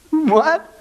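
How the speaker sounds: high, laughing disbelief; incredulous what. Real, not acted. Preacher mocks the congregation's doubt, laughing incredulity.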